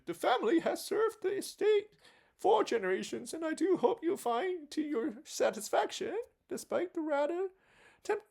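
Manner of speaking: butler voice. Acted. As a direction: play a fussy high butler. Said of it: "I don't know what voice that is".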